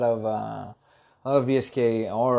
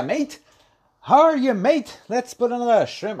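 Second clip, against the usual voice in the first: higher, louder mock Aussie accent; bad Australian accent; 9 semitones higher, 4 dB louder than usual. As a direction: put on a hammed-up, high-pitched Australian accent. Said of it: "Australian accent".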